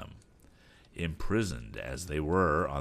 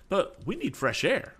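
high-pitched, insistent complaint; worried complaint, pitched high; fretful passenger; 12 semitones higher than usual. A narrator's reading. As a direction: press the point in a raised pitch. Fretful passenger demands air, then echoed.

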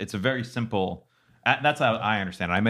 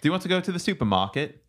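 put-on British accent; 7 semitones higher than usual. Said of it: "continue to do a British accent".

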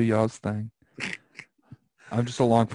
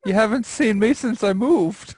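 hurt complaint; 9 semitones higher, 5 dB louder than usual. Real, not acted. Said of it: "he is so sad right now".

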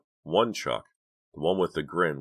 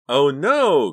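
high, loud frightened cry; alarmed wail, pitched high and loud; trembling cry; 10 semitones higher, 11 dB louder than usual. A narrator's reading. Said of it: "her voice trembling".

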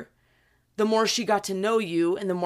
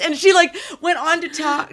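ordinary talk

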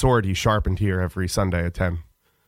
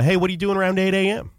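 casual text; 8 semitones higher, faster than usual. Retold or imitated.

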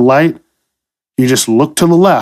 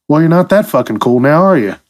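ordinary delivery

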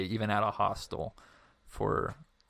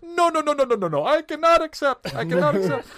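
put-on high, loud, insistent refusal in a Middle Eastern accent; 7 dB louder than usual. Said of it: "I am gonna do the accent here".

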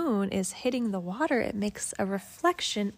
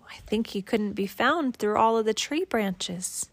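ordinary delivery

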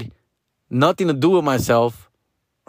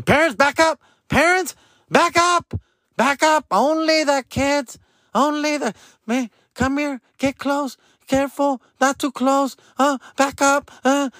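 school staff yelling; 11 semitones higher than usual. Retold or imitated. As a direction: bark at the parents like a fussy school guard. Staff yelling at parents at gate.

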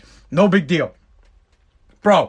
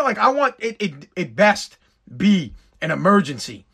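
ordinary talk

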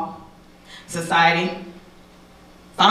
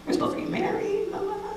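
much higher, softer mock of the promise; mocking high sing-song; 12 semitones higher, 9 dB quieter than usual. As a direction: toss it away in a high sarcastic lilt. Mocking his empty promises.

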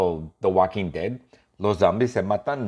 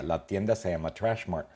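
plain reading voice

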